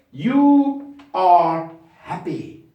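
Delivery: you are happy, very slow; slow model read; slow dictation. Shown as a demonstration. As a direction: stretch it out for the class. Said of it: "modelling sentence".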